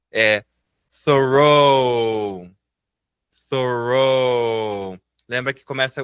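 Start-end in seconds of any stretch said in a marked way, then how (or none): 1.0-5.0 "thorough" drawn out, THUH-roh; tongue between teeth to start "thorough", long final vowel; careful pronunciation. Shown as a demonstration. Teacher modelling a hard word, "thorough" slowly as THUH-roh. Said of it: "palavras mais difíceis de pronunciar".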